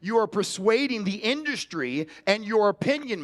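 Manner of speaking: ordinary delivery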